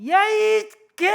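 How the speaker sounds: high, drawn-out road-rage roar; hammed-up angry shout. Driver about to curse another car, exaggerated shout. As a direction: bellow the start of a curse, stretched out. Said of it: "입을 열고 그냥".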